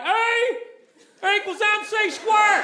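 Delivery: put-on Southern-accented Einstein shouting his formula. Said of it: "if Albert Einstein had a really harsh Southern accent".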